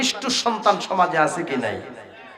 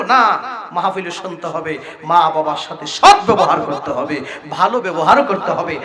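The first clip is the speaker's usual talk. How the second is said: usual speaking voice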